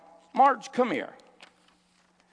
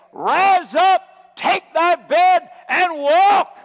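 high, full-force preacher's shout; Savior's commanding shout; 9 semitones higher, 9 dB louder, much slower than usual. Acted. Preacher voicing the Savior to the congregation, shouted command. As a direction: thunder the command, phrase by phrase. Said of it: "hear the voice of your Savior say".

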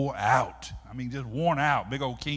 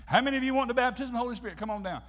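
quick, higher altar-call invitation; preacher's altar call; 9 semitones higher, 4 dB louder, much faster than usual. Acted. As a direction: call the congregation forward in a rush. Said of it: "this is how you get prayed for".

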